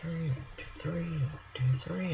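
counting blocks in a soft whisper. Real, not acted. Builder counting blocks under his breath, whispered. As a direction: breathe the numbers, barely voiced.

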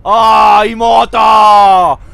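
loud startled call; loud startled shout of the sister's name. Real, not acted. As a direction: yell out at the sister suddenly. Spots sister, calls out.

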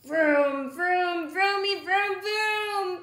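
a pigeon pretending to drive; vroom engine noises. Pigeon imitating a bus.

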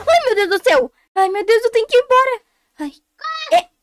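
grito agudo e alto de pavor; pânico. Real, not acted. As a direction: exclame em pânico, querendo fugir. It aparece, foge em pânico. (apavorada, voz bem aguda)

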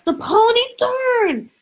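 high, loud startled cry; sudden dismay. A narrator's reading. Chloe suddenly remembers the pony, high dismayed squeal. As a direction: squeal it as the loss hits her.

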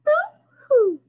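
playful fake cry; mock crying. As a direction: ham up a baby boo-hoo. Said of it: "if we say boo-hoo".

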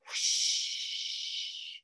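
long soft airy whoosh; wind whoosh. Acted. Storyteller imitating a cold winter wind, airy whoosh. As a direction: blow out a whispery rush of wind.

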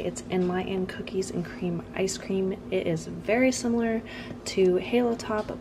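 soft late-night hush; quiet voice, house asleep; whispering. Real, not acted. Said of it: "Sorry that I have to whisper".

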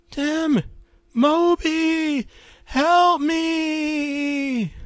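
very high, loud scream for help. Acted. Annie pulled back into the tornado, high desperate scream. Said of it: "she screamed".

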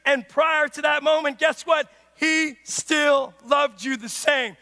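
emphatic preacher, voice up; full-force preaching. Acted. Preacher telling the congregation of unconditional love, full-force preaching. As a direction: drive it home to the congregation.

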